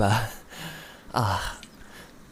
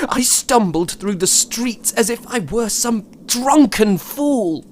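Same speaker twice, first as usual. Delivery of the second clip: distraught rise; 8 semitones higher than usual. Acted. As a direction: pitch climbing, near breaking. Recounting stumbling, distraught.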